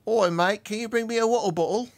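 a cartoon British bloke asking for water; mock British accent.

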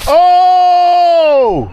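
long, high, loud "oh" of triumph; triumphant whoop. Real, not acted. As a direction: hold one elated yell. Lands a huge fish.

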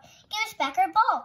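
high, loud demand; crying out. A narrator's reading. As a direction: cry out for it back. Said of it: "cried Sam".

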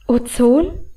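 a puzzled, rising question on Ozon; surprised echo. Acted. She echoes her brother's word in surprise, puzzled rising Ozon. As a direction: repeat Ozon back, caught off guard. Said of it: "Das hatte ich nicht erwartet".

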